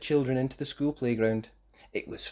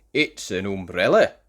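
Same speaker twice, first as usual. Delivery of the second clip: proud; 4 semitones lower, 6 dB louder than usual. A narrator's reading. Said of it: "said Tom proudly".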